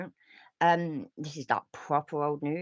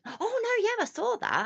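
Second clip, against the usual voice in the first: surprised recognition; 4 dB louder than usual. Real, not acted. Reacting to chat.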